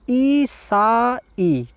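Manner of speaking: ईसाई with a long, drawn-out ई at the start. Shown as a demonstration. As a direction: stretch the ई in ईसाई very slowly. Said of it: "तो देखिए बड़ी ई होती है".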